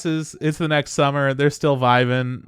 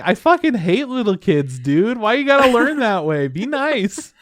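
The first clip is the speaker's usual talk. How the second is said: high, loud mock rant at the kid; mock scolding; 10 semitones higher, 5 dB louder than usual. Reacting to the girl grabbing the spirit, mock outraged scolding. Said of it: "I'm like".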